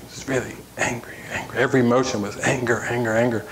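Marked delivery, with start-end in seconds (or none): 0.1-1.6 laughing softly. Real, not acted. Laughing while telling anger story.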